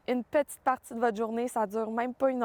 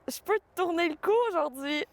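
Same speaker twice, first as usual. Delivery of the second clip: excited; 6 semitones higher than usual. Retold or imitated. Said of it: "Le matin, je me réveillais".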